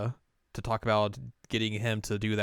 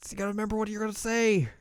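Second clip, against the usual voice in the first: exasperated prodding; 12 semitones higher, faster than usual. Retold or imitated. Prodding the frozen director, retold.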